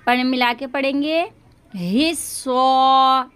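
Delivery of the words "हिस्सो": हिस्सो very slow, louder, ह running into स; ha joined to sad. Shown as a demonstration. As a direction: join ह to स in हिस्सो. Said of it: "हा को स्वाद से मिला के पढ़ेंगे". (ह को स्वाद से मिलाकर)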